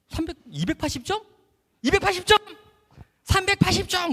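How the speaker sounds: high-pitched, incredulous calling; re-enacted high holler; disbelieving exchange. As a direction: shout it back and forth in disbelief. Disbelieving shouted exchange across field.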